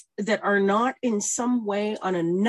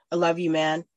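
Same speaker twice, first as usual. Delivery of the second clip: normal talking voice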